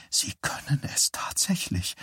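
breathless awed whisper. A narrator's reading. Eileen to Johnny in the hallway, awed whisper. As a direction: hush every word, barely any voice. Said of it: "flüsterte Eileen".

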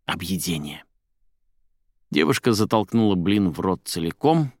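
ordinary delivery, nothing marked

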